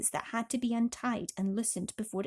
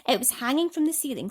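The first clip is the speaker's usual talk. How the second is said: plain reading voice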